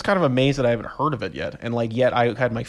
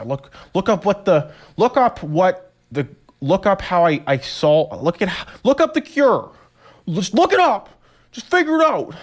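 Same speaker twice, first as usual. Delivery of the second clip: higher, louder, urgent pleading; frantic retold plea; 8 semitones higher, 6 dB louder than usual. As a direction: beg him to look it up, flustered. Frantic retold plea about leech.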